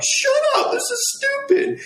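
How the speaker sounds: imitating himself tearfully telling his brother off; a half-laughing retold tearful snap; crying annoyed snap. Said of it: "I'm getting pissed off, which is making me cry more".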